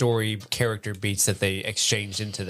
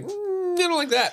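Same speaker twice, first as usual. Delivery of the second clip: disgusted. Retold or imitated. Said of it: "The sniffing thing got me like".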